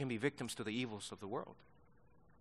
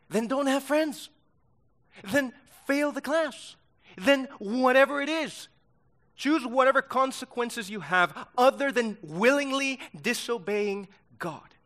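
higher and louder, pounding each "then"; fiery preaching; 10 semitones higher, 9 dB louder than usual. Acted. Pastor rebuking excuse-makers, forceful preaching. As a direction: preach it hard, no excuses allowed.

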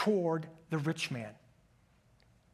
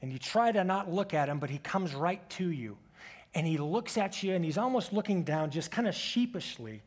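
ordinary delivery

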